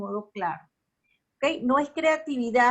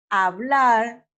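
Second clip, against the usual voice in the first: loud "hablar", punched on final "blar"; stress on last syllable; 7 dB louder than usual. Shown as a demonstration. Teacher gives an example of agudas, loud "hablar" stressed on "blar". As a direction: hit the end of "hablar" hard. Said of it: "la fuerza se le da a la última sílaba".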